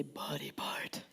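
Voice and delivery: breathy whisper, slow and soft; whispered call and response. Shown as a demonstration. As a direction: breathe the words out low. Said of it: "Everybody say".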